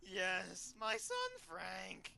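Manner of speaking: high, slow put-on mom voice; high mock-sad mother. [name] tells the employee about her son, high and slow. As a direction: whine it like a grieving mom.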